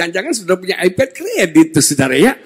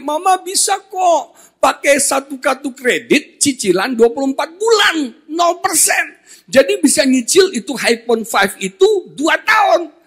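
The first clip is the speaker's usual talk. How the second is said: put-on whining child; 8 semitones higher than usual. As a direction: beg mama like a spoilt kid. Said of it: "Tapi nih anak merengek". (rengekan anak manja yang tinggi)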